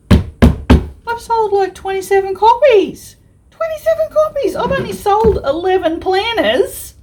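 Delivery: thrilled high squeals about sales; bursting with delight, voice shooting up; excited. Real, not acted. Vlogger squealing to viewers about sold copies, excited.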